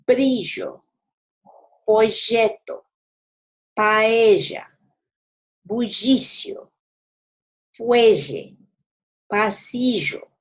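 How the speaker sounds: ll in Fallecer, orilla, bolsillo buzzed like the s in measure; Argentine-style zh for ll; zh sound for ll. Shown as a demonstration. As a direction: say each ll as a voiced zh. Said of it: "Now let's try the z sound".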